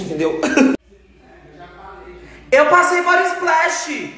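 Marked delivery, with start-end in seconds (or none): 2.5-4.1 high, slow shouted line; shouted confession. Real, not acted. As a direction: call it out, half laughing. Laughing confession, called out.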